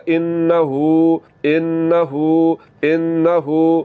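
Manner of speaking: انہو very slow, the final u drawn out; slow correct example; long u. Shown as a demonstration. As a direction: stretch the u in انہو. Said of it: "اس کو لمبا کرنا ہے".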